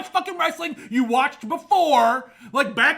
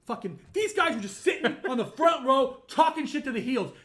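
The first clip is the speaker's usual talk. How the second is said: ordinary talk, nothing marked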